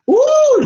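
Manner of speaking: high loud squeal; scared squeal. Real, not acted. Imagining the tide, a high loud squeal. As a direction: squeal at the thought of the water. Imagining being buried by the waves.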